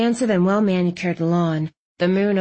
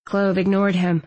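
ordinary talk, nothing marked

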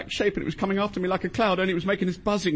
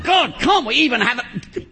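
loud, higher, drawn-out protest; exasperated shout; 6 semitones higher, 5 dB louder, slower than usual. Acted. Interrupted caller protesting at the telling-off, exasperated. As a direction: burst out in frustration. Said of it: "could you keep it down a bit".

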